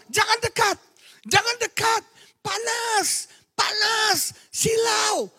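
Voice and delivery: very high strained demon voice, crying out; possessed shriek. Retold or imitated. Possessed youth shrieking at the preacher, very high. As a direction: shriek like a spirit in pain. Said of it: "dia bilang".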